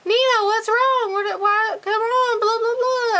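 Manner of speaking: high-pitched mock dad voice; high, singsong parody of a father; mimicked impatient dad. Acting out her waiting dad.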